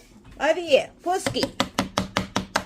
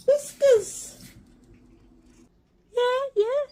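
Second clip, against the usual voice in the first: high crying-edged coo at the cat; pet talk; 10 semitones higher, slower than usual. Real, not acted. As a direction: squeal the cat's name fondly. Greeting cat Whiskers.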